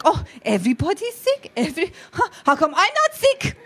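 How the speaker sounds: mocking mom. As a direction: scoff at the excuse as the mom. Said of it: "She's like".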